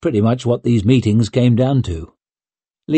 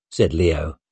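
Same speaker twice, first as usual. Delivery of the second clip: ordinary delivery, nothing marked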